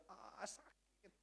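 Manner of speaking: slow, quiet pained moan, nowhere near a yell; a hushed retelling of the runner's cry; understated pained groan; imitating a pained cry. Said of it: "dia teriak".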